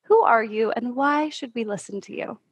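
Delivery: normal talking voice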